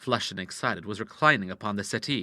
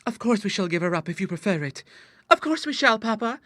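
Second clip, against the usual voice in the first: put-on high girlish assurance, climbing higher; coaxing daughters; 10 semitones higher than usual. A narrator's reading. Daughters cuddle father and give in, coaxingly. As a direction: reassure sweetly in a raised girl's voice.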